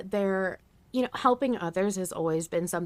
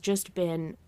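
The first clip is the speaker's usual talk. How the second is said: usual speaking voice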